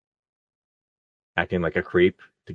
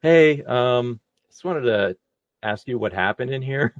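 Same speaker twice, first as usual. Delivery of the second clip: lightly voiced manager, a bit higher in pitch; hesitant, higher-pitched manager checking in; imitated theater manager; 5 semitones higher, slower than usual. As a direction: retell the manager's tentative question, pitched up. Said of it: "He's like".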